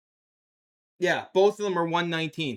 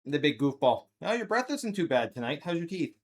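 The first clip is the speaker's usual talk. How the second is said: normal talking voice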